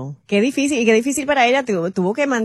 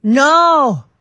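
a loud, higher burst of disbelief; shocked no; 7 semitones higher, 8 dB louder than usual. Real, not acted. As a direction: gasp it out in real shock. Shocked reaction to ghost story.

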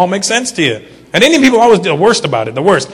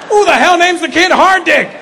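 incredulous shout; 10 semitones higher than usual. Real, not acted. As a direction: yell it in mock outrage. Outraged at the name.

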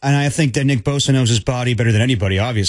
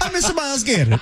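high laughing fake Italian; hammed-up Italian fan; mock Italian accent; 11 semitones higher than usual.

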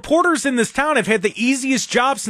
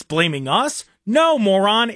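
normal talking voice

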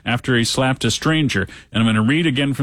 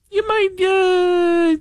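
squeaky voice; much slower than usual. Pretend. Silly squeaky voice break.

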